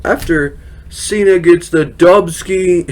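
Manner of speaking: usual speaking voice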